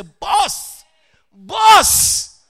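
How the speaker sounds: high shouted call to the boss; urgent calling out. Retold or imitated. Acts out calling his boss.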